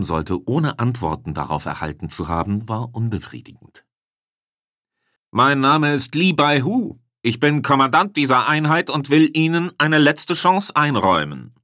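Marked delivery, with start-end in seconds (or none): 5.3-11.5 commanding voice of a general addressing everyone; booming announcement. A narrator's reading. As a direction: boom it out as if amplified across a street. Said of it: "drang eine dröhnende, akustikfeldverstärkte Stimme".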